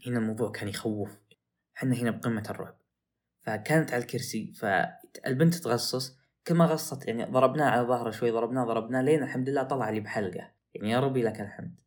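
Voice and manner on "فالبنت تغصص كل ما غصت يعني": ordinary talk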